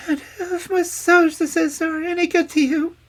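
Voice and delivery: very high, loud, tearful plea; bursting into tears. A narrator's reading. Princess offering her services to the hag, tearfully. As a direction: offer yourself through acted tears. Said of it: "bursting into tears".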